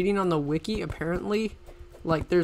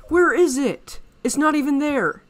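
frantic, higher and louder searching; real panic as the loot vanishes; breathless high-pitched alarm; panicking; 9 semitones higher, 6 dB louder than usual. Real, not acted. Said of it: "I'm panicking so much right now".

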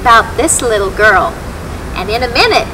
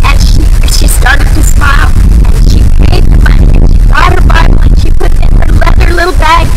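excited; 3 semitones higher, 7 dB louder than usual. A narrator's reading. Joyful climax of story.

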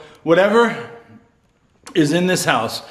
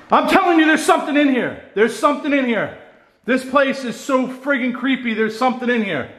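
nervous fear; 8 semitones higher than usual. Real, not acted. Scared alone in creepy house.

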